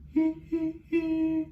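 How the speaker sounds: light high sing; high hee. Shown as a demonstration. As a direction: lift into head voice. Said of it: "can we go".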